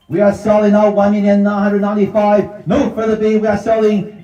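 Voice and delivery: high, driving auction call; auctioneer calling. Real, not acted. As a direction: rattle off bids like an auctioneer.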